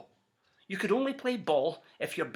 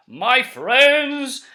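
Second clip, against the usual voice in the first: booming showman's greeting, much louder and higher; grand announcement; 7 semitones higher, 12 dB louder than usual. Acted. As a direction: call out like a salesman to a crowd. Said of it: "he announced in a voice clear and keen".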